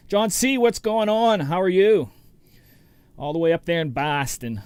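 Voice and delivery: put-on a light, playful Boston accent on the greeting; hammed-up Boston greeting, only lightly put on; mock Boston accent. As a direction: tease a Boston viewer with a hint of a Boston accent. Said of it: "I have to do that every time. I apologize. I promise I will not do accents".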